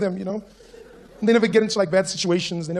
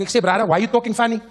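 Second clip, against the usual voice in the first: put-on high Cape Town accent; straight-faced Cape guy; 6 semitones higher, 4 dB louder than usual. Local questions the visitor's speech, puzzled Cape accent. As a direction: ask it in earnest, pitched up, Cape accent. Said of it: "looks at me straight face".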